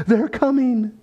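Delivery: high, mock-panicked warning; fearful woman. Woman bursts into the study to warn him, imitated fear. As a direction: imitate a frightened woman raising the alarm. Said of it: "with fear in her eyes".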